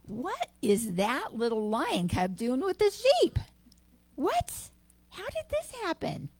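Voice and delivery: climbing into a very high, startled what; shocked and baffled, pitch leaping up; astonished lioness. Acted. Lioness spotting the cub with sheep, climbing in astonishment.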